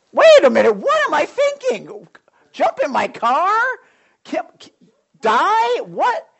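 high, loud and slowing in shock; alarmed realization. Retold or imitated. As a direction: catch yourself in horror. Snapping out of the temptation.

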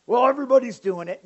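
loud, high, quick mimicked excuse; mock excuse-making. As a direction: blurt it like a lame protest.

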